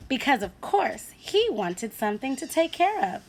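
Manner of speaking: usual speaking voice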